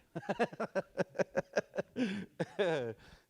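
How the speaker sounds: drawn-out high laughter; long high giggle. Real, not acted. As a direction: keep laughing at the joke. Laughing at the joke.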